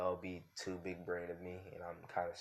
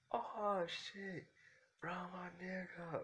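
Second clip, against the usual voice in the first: much higher, hyped-up character voice; hyped yell; 12 semitones higher, much slower than usual. Retold or imitated. Retelling the story, a classmate hypes up his friend in a high, excited voice. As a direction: shoot way up in pitch, overjoyed to see his friend.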